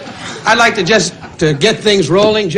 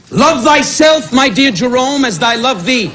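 slow, higher, mock-solemn scripture reading; hammed-up holy proclamation, drawn out and high; mock Talmud recital; 6 semitones higher, slower than usual. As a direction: intone it like a pompous rabbi. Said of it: "And I quote from the Talmud".

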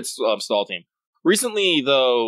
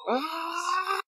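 frustrated cry; 6 dB quieter than usual. Real, not acted. Frustrated cry over bones.

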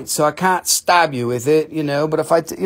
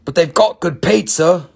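lower, put-on British voice; British accent; 4 semitones lower than usual. Seller flees mean New York, put-on British accent. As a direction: switch back into mock British. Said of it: "back to British".

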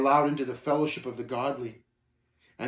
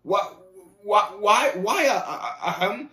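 stuck on the first words, repeating in pitched-up bursts; flustered stammer; 6 semitones higher, 4 dB louder, slower than usual. Acted. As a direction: stammer it out, higher and louder at first. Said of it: "and stammered".

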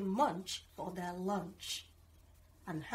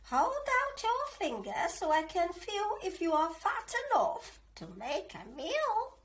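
higher witch voice; 8 semitones higher than usual. A narrator's reading. Witch to Hansel, higher and greedy. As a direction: prod him like a greedy witch. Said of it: "the witch told Hansel".

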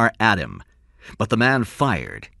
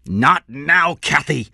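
raised, urgent shout; 5 semitones higher, 4 dB louder, slower than usual. A narrator's reading. Husband cutting off Kathy on the phone, shouting. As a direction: shout her down mid-call. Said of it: "he shouted".

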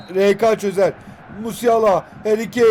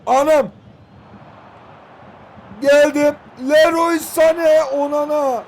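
excited yell; 6 semitones higher, 5 dB louder, slower than usual. Real, not acted. Sudden attack in the game.

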